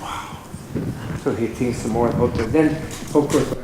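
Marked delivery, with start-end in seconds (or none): 0.0-0.4 soft, low murmur of awe; quietly amazed, as if taken aback; hushed amazement. Acted. Pope taken aback by the rabbi's answer, hushed amazement.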